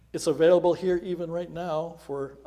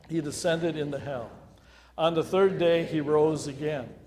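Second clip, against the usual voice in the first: louder, rhythmic recitation, proclaiming each phrase; reciting the creed; 3 semitones higher, 6 dB louder than usual. Acted. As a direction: lead the congregation in the creed with conviction. Leads the Apostles' Creed aloud.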